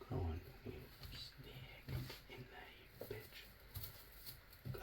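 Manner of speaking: whispered cursing at the wire; hissed curse. Acted. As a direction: hiss it under your breath. Cursing the wire under his breath.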